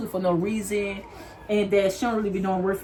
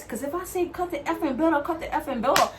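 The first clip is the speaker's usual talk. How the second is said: mocking coworker; 6 semitones higher than usual. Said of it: "she was just like this".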